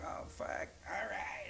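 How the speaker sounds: unvoiced hushed mutter; muttered to himself at the party; whispered aside. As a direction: breathe it under your breath, resigned. Quiet reaction before standing up.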